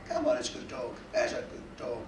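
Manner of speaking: low, soft sweet-talk; hushed, low pet-owner coaxing; coaxing a dog. Acted. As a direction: lure the dog over gently and quietly. Coaxing his dog with a tablet.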